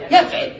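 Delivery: يفعل said yaf-'il, second vowel i; kasra on ain. Shown as a demonstration. Teacher giving present-tense patterns, يفعل as yaf'il. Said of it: "والكسر".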